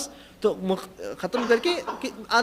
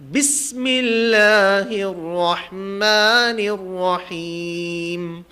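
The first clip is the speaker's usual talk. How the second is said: slow, drawn-out chanted tilawat, louder; Quran recitation; 4 dB louder, much slower than usual. Shown as a demonstration. Teacher reciting to class, slow chanted tilawat. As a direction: chant each word long and steady.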